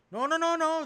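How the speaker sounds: high, loud imitated judge interrupting; judge cutting in. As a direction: shout the judge down over him. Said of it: "the judge cut him off".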